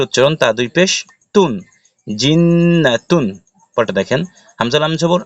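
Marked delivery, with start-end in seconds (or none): 2.1-3.3 জিন্নাতুন slow and higher, long humming nn; nasal ghunnah. Shown as a demonstration. Teacher joining the syllables for students, slow nasal recitation. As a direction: hold the nn of জিন্নাতুন in the nose. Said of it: "হরকতের বামে নুনে তাসদিদ আসছে".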